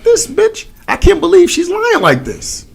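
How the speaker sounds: very high-pitched mock-weepy whine; mock crying. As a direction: retell it as a put-on tearful wail. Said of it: "I started crying".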